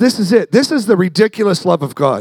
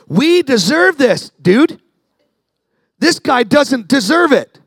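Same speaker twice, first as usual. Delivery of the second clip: high, loud-edged, drawn-out protest; indignant rebuke; 9 semitones higher, 4 dB louder, slower than usual. Retold or imitated. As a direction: plead the case hard, acting out the thief. Acted rebuke of the mocking prisoner.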